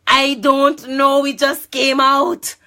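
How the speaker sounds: loud, high, drawn-out protest; flustered outburst. Retold or imitated. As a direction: wail the excuse slowly and loudly. Acted flustered excuse to him.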